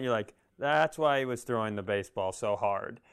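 ordinary talk, nothing marked

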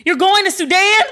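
high, loud disbelieving question; shocked mother. Retold or imitated. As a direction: gasp it out as an alarmed mom. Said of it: "My mother was like".

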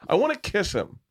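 lightly voiced eager girl, pitched high; mock girly voice. Comic retelling a girl in the crowd, mock girly voice.

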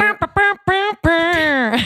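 high, blaring "bah" horn noises; imitated trumpet. Imitating a New York trumpet player, brassy and high. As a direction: toot it out like a trumpet.

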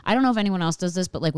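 ordinary talk, nothing marked

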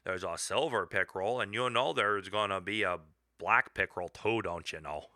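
hammed-up Canadian accent with rounded vowels. Canadian local explaining pickerel to visiting Yankees, hammed-up Canadian accent. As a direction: put on a folksy north-country Canadian lilt. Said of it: "you gotta have a little bit of an accent".

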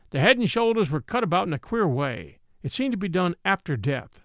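plain reading voice

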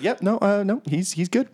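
lightly voiced cheerful clerk; chirpy reassurance. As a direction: brush it off brightly. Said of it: "And she's like".

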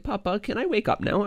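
put-on high, sweet little-kid voice; toddler voice. Son asking papa in the morning, high little-kid voice. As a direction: ask like a sleepy three-year-old. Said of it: "He's like".

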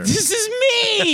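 very high, drawn-out insistence; squealed protest. Real, not acted. Comic insisting to friend this is him, squealed.

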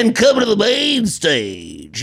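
slow drawn-out DJ announcer; strip club announcer. Acted. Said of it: "it'd be like a D J-ish".

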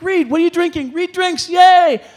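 high, loud cheering of friends. Retold or imitated. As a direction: cheer him on like a rowdy bar crowd. Friends cheering him on at bar.

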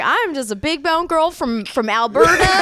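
exaggerated country-singer accent, mimicking a caller; [name]'s country accent. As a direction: playfully imitate a caller's big country accent. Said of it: "She was like".

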